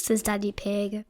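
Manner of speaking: usual speaking voice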